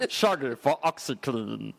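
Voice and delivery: slow mock dancehall pitchman voice. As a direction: draw it out like a reggae star's advert. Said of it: "doing a [name]".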